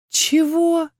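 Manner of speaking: high-pitched baffled question; bewildered. A narrator's reading. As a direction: ask it completely thrown. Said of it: "растерялась я".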